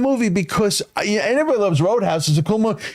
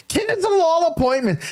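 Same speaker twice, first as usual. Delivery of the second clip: high, drawn-out mock announcement; exaggerated high-pitched catchphrase; Crazy Mark; 10 semitones higher, slower than usual. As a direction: call it out high and slow. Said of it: "like, Crazy Mark would say".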